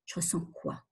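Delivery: soft, breathless whisper asking herself; whispered self-question. Shown as a demonstration. Coach models asking herself, in a whisper. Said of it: "quel type d'émotion je ressens là?".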